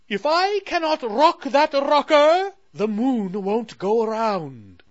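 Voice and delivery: high, loud grave royal voice; explaining Moon Queen. Acted. Queen telling Sally the moon will stop, high and grave. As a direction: spell out the danger like a worried queen. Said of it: "explained the Moon Queen".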